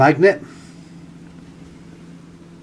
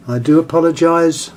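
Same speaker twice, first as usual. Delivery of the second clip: usual speaking voice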